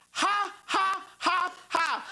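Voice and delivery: high mocking ha ha ha; son's taunting laugh. Little son laughing at his hurt dad, high mocking laugh. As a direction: cackle it like a cheeky little boy. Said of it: "It's not funny".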